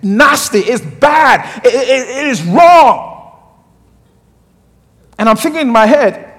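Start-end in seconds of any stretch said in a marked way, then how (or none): 0.0-3.3 slow, stern mother laying down the law, ending in a shout. Retold or imitated. Mother warning her teenage son, slow and stern with a shouted end. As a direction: drag it out like a strict mother forbidding it. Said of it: "one day she said to me".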